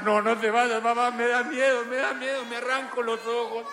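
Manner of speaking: niño suplicando. Pretend.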